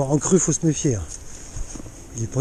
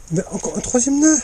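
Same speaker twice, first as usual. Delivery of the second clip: high disbelieving question; exasperated surprise; 9 semitones higher than usual. Real, not acted. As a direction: pitch up in annoyed disbelief. Finds another knot in line.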